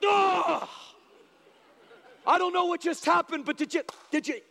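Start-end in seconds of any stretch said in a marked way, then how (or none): 0.1-0.7 sharp loud guttural grunt; a battle-cry grunt from the gut; war grunt. Retold or imitated. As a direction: clans uniting with one loud grunt. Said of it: "they go, like, and it's just like".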